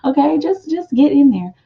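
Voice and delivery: high, delighted squeal of a question; flattered disbelief. Pretend. The admired reader asking her fans, in high flattered surprise. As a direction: play someone stunned at being admired.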